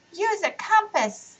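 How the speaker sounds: put-on high, slow eager child; a bright kid with an idea.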